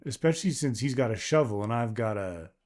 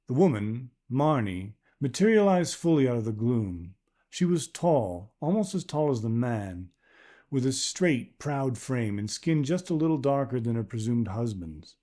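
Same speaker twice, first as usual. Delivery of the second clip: ordinary delivery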